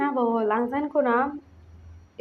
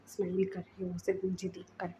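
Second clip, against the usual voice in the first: softer, lower grudging give-in; softened; 3 semitones lower, 8 dB quieter than usual. Acted. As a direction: give in quietly. Wing gives in, softens. (धीमी आवाज़ में मान जाना)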